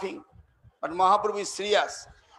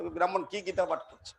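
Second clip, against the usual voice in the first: mocking; 4 semitones lower, 7 dB quieter than usual. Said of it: "সবাই ঠাট্টা করছে, মস্করা করছে".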